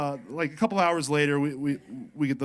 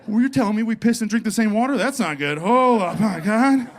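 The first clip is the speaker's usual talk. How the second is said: much higher, louder, laughing fish dude; stoned fish voice; 7 semitones higher, 6 dB louder than usual. Pretend. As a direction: play a baked fish freaking out. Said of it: "They're probably like".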